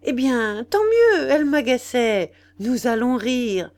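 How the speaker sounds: loud, high-pitched relish; defiant glee. A narrator's reading. Nana delighted at her rival's scandal, defiant glee. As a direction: crow over it, loud and defiant.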